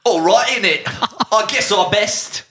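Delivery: high, loud hammed-up Welsh accent; mock Welsh accent. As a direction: ham up a Welsh accent, laughing through it. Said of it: "That's a Welsh accent".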